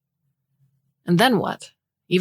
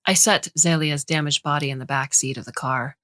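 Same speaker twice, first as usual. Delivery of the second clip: ordinary delivery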